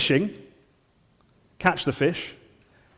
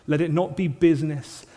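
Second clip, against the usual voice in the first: ordinary delivery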